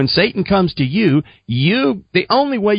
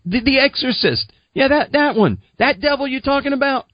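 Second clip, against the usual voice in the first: high, excitable dude voice; naive eagerness; 11 semitones higher than usual. Retold or imitated. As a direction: pipe up as your clueless younger self. Acting out naive, eager younger self.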